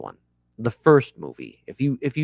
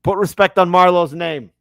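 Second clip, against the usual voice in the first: higher, louder, insistent Wire quote; 6 semitones higher, 9 dB louder than usual. Shown as a demonstration. Quoting The Wire to the audience, louder and insistent. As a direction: demand it like Marlowe himself.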